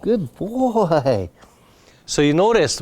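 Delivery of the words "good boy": bright praise to the dog; happy praise. Real, not acted. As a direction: reward him with your voice. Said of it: "now I'm super happy".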